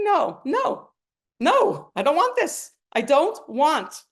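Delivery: high, loud pleading no; protesting refusal. Pretend. Listener refusing hardship, high loud protest. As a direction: push back like someone refusing their burden.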